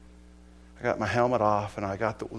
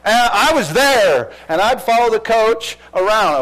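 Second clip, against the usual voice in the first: excited; 9 semitones higher, 12 dB louder than usual. Real, not acted. Excited retelling.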